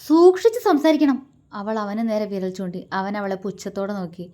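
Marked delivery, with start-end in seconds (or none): 0.1-1.2 much higher, louder warning; wife warning her husband, loud and stern. A narrator's reading. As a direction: jab the warning at him. Pointing a finger, warning him.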